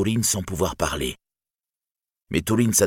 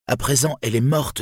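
high, incredulous echo of a claim; accusing; 7 semitones higher than usual. A narrator's reading. Turin doubting Brandir's news of Niniel, accusing. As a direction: throw the words back at them.

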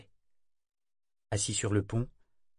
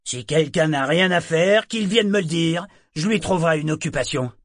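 sharp bark, much louder and higher; curt shout; 7 semitones higher, 9 dB louder than usual. Acted. Captain ordering gawking sailors back, curt shout. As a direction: send them off in one snap. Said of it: "leur cria-t-il sèchement".

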